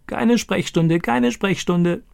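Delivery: high, slow squawking parrot voice. Acted. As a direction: crow it like a talking parrot. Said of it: "kräht er". (hoher krächzender Papagei)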